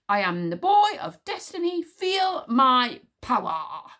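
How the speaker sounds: loud, high, slow proclamation; grandiose boast. Acted. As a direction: proclaim it like a conquering hero. Boasting at full pitch.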